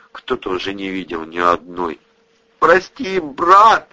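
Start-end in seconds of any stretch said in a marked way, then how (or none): 2.6-3.9 high loud sobbing plea. A narrator's reading. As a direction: sob it out in a fevered sleep. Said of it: "всхлипнул сквозь дрему забинтованный".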